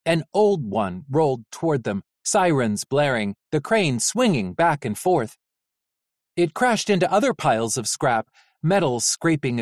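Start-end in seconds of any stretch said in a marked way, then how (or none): none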